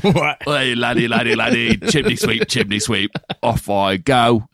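a hammed-up mock Cockney chimney sweep; bad Cockney accent. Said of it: "it was a Cockney one".

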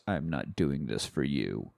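low, quiet, slow put-down; cold rebuff. Retold or imitated. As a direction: imitate an estranged wife's icy, hushed reply. Estranged wife's cold reply retold.

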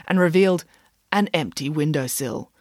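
ordinary delivery, nothing marked

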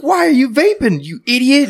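high nagging scold; scolding girlfriend. Retold or imitated. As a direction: scold him like an annoyed girlfriend. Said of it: "she's like".

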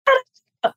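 a sudden high yelp of mock alarm; mock panic yelp. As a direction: yelp like you just got spooked. Mimicking fight-or-flight panic.

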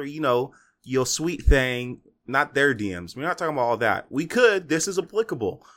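ordinary talk

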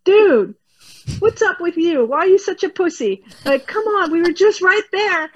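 mock-whine at a friend who bailed; frustrated cortex. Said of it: "The cortex is like".

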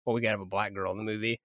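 quick, low and quiet throwaway quote; offhand mock aside. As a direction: toss it off fast and under your breath, as a casual decision. Imagined executive's token casting remark.

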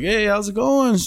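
high-pitched friendly hello; bright greeting. Retold or imitated. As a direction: re-enact a greeting, pitched well up. Greeting Susan in the story.

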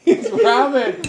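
cracking up, words drawn out slowly; laughing. Real, not acted. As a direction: squeeze it out through helpless laughter. Players cracking up over the game.

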